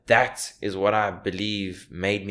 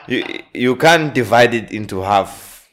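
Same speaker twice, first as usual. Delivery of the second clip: laughing; 4 semitones higher, 8 dB louder than usual. Real, not acted. Laughing through the Zuma bit.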